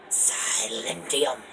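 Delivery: very slow ancient whisper; muttered spell. Acted. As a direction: breathe the spell under your breath. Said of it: "mutters something under his breath".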